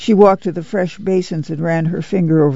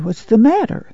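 higher-pitched, startled-awake demand; 6 semitones higher than usual. A narrator's reading. Pa woken by Ma's fuss, demanding. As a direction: demand to know what is wrong. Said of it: "Pa demanded".

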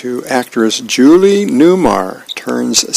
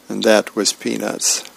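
ordinary talk

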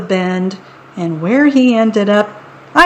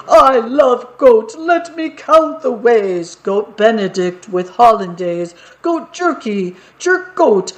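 gleeful troll. Acted. Troll gloats over goat recipes.

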